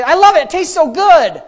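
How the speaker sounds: loud, high mock raving; gushing fan. Raving about grandma's cookies, gushing. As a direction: gush over the cookies, hammed-up.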